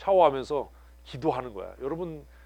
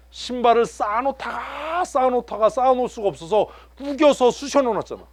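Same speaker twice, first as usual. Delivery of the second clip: excited exaggeration; 10 semitones higher, 9 dB louder than usual. Real, not acted. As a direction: belt it out in disbelief. Exaggerated tale of crammed shoes. (높고 크게 흥분해서 과장하며)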